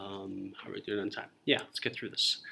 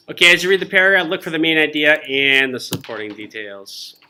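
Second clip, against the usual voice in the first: loud restart; 4 semitones higher, 11 dB louder than usual. Real, not acted. Teacher restarts loudly after joking.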